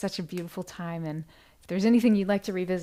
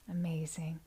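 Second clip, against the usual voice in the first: whisper; 3 semitones lower, 8 dB quieter than usual. Real, not acted. Host reacting to viewer comment.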